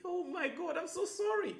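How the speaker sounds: high, soft, pleading sorry; gushing apology. Shown as a demonstration. Showing how to apologize to a customer, high gushing sorry. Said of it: "There's how you even be sorry".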